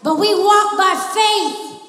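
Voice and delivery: loud, rising sermon cadence; full-force preaching. Acted. Preacher rallying the church to fight, loud preaching. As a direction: preach it out to the congregation.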